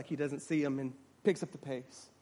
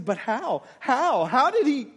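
raised, emphatic disbelief; incredulous questioning; 8 semitones higher, 8 dB louder than usual. Acted. Man asking the woman about the healing, incredulous questioning. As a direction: press the question in amazement, voice up. Onlooker cannot believe the healing.

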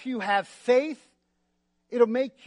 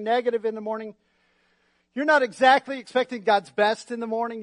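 ordinary delivery

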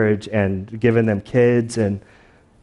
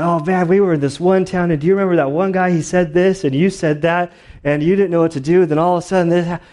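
higher, animated retelling; excited sharing; 6 semitones higher, faster than usual. Acted. Disciple debriefing with his partner about their journey, acted-out excitement. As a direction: swap memories with eager excitement. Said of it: "like, excitement and passion".

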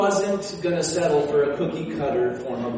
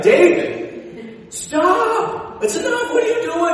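high shrill-free alarmed shout, much higher; Michal yelling; 13 semitones higher, 4 dB louder, slower than usual. Retold or imitated. Wife shouting down at David to stop. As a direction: call out to stop him, pitched way up. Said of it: "and she's like".

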